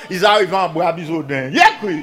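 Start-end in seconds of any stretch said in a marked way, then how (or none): 1.5-2.0 high, loud call to the crowd; storyteller's call. Real, not acted. Storyteller to listeners mid-tale, calling out loudly. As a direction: fling out the call for a response.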